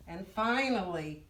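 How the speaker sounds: ordinary delivery, nothing marked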